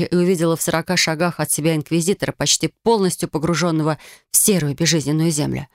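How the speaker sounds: plain reading voice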